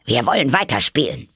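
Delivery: high, loud royal command; imperious queen. Acted. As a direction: order it as a domineering queen. The raging Queen commands Alice. (herrisch befohlen)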